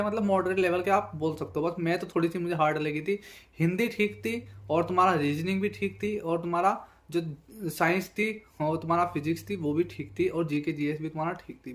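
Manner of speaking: usual speaking voice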